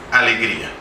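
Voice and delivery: normal talking voice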